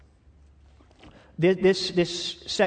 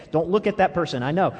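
ordinary talk

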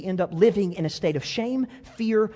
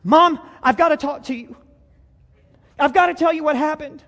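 put-on high, loud, urgent boy's plea; desperate plea; 9 semitones higher, 7 dB louder than usual. Boy begging his mom to hear a confession, urgent. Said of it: "Johnny just goes".

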